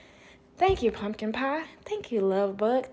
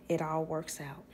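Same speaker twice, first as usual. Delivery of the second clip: whisper; 6 semitones lower, 10 dB quieter than usual. Retold or imitated. Grandma's last words, whispered.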